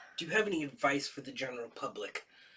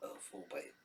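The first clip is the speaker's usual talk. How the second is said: dreading whisper; 3 semitones lower, 15 dB quieter, faster than usual. Real, not acted. Dreading the hot pepper.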